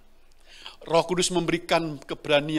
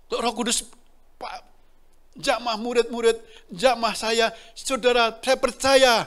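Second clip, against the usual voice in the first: high, loud fervent proclamation; preaching at full force; 7 semitones higher, 6 dB louder than usual. Real, not acted. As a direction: cry out the declaration of faith. Preacher's climax of faith. (berkhotbah dengan penuh semangat, keras dan tinggi)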